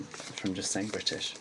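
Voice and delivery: usual speaking voice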